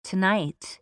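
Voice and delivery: measured meditation voice with long pauses; guided meditation. Acted. Host guides listeners on an inner journey, measured guided meditation. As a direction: lead listeners inward, leaving space after each phrase.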